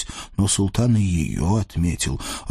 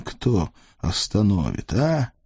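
usual speaking voice

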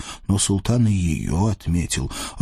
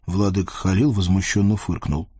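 usual speaking voice

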